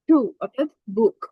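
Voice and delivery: usual speaking voice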